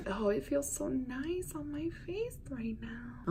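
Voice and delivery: breathy whisper to the camera. Real, not acted. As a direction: whisper it close to the mic. Soft aside while applying makeup.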